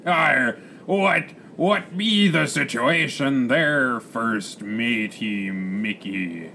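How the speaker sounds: loud, growled pirate brogue, drawn out; hearty pirate captain. Acted. Captain asks first mate about the situation, hearty pirate. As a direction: roll out the Rs like a swaggering pirate.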